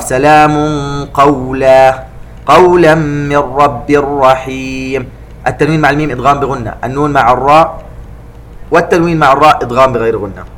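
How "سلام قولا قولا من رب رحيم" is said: قولا recited slowly, the tanween hidden into a heavy qaf; careful ikhfa of the qaf. Shown as a demonstration. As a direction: stretch قولا and hide the nun before the qaf. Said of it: "وننتبه ... إلى طريقة إخفاء القاف". (إخفاء التنوين عند القاف في قولا)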